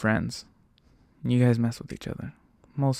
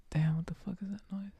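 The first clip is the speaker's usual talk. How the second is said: hushed, breathy side remark; real whispered muttered aside; 9 semitones higher, 8 dB quieter, faster than usual. Real, not acted. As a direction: drop to a whisper, distracted by a noise. Distracted aside mid-story.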